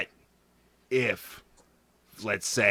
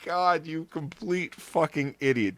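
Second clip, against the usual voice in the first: laughing disbelief; 6 semitones higher, 4 dB quieter than usual. Real, not acted. Laughing at Santorum's joke.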